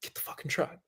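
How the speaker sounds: very soft, half-whispered order; hushed, controlled command under the breath; quiet insistence. Retold or imitated. Injured rider insisting to his arguing sister, very quietly.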